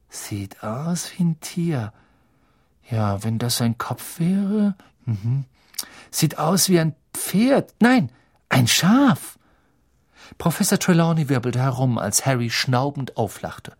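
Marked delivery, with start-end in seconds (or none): 0.1-9.4 drawn-out musing rising to high exclamations; Ron guessing shapes. Acted. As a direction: think aloud slowly, then jump up on the guess. (Ron, gedehnt rätselnd, dann hoch ausrufend)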